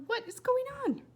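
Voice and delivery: put-on high, alarmed boy's voice; startled boy. A narrator's reading. William alarmed by the flying squirrel, high and startled. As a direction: squeak it out in alarm.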